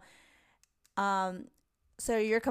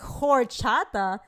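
drawn-out, louder questioning "Horchata?"; surprised; 3 semitones higher, 6 dB louder, much slower than usual. Retold or imitated. Reading the bar name her friend sent, drawn-out surprise. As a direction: stretch "Horchata?" out, puzzled and loud.

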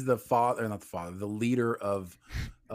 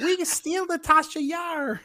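high, slow goofy Gungan voice; Jar Jar voice; 7 dB louder, slower than usual. Retold or imitated. Mocking George Lucas's Gungan, silly high goofy voice. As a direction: ham up a silly high alien, dragging it out.

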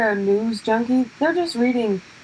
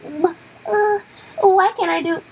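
high, hesitant mock whine; mock envious complaint; 9 semitones higher than usual. Comic mocks jealous comics, high envious whine. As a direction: imitate a frustrated wannabe, pitched well up.

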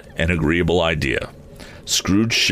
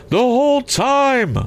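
very high, slow phantom wail; tormented ghost; 4 dB louder, slower than usual. A narrator's reading. Ghost telling Scrooge of endless travel, tormented high wail. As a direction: draw it out high, as a soul without rest. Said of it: "said the ghost".

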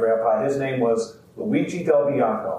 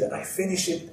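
put-on "finish" higher then falling, much softer, Italian accent; Italian accent, softer emphatic; 6 semitones higher, 7 dB quieter than usual. Carver telling the crowd, softer Italian accent. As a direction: land "finish" with proud finality. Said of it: "heavy kind of Italian accent".

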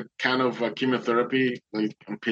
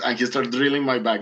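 normal talking voice

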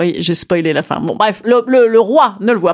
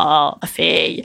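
low, smooth crooning father; suave Jean Marais voice; 5 semitones lower, 4 dB quieter, slower than usual. Retold or imitated. The king calls his daughter, low and suave. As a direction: purr it like a suave screen king. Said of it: "il parle pas du tout comme ça".

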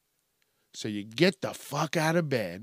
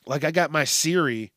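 usual speaking voice

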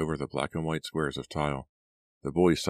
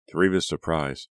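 ordinary delivery, nothing marked

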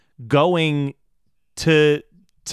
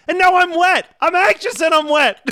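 high, loud comic hysterics; mock hysterical outburst; imitated yelling; 13 semitones higher, 5 dB louder than usual. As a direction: wail it loud, imitating a movie meltdown. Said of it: "where he's yelling about".

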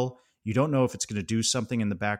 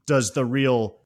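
ordinary talk, nothing marked

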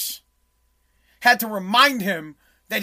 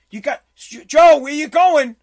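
louder and slow, calling after someone walking off; mock calling out; 3 semitones higher, 7 dB louder, slower than usual. As the vice president calling after the departing president, loud and drawn out. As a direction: call after him as he leaves. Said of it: "has to go".

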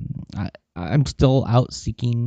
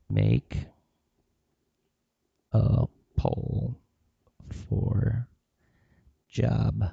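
much softer, halting murmur to himself; mumbling while writing; 8 dB quieter, slower than usual. Real, not acted. Jotting a note, muttering each word under his breath. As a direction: drop to a near-silent mutter as you write each word. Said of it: "I'm gonna write it down right now".

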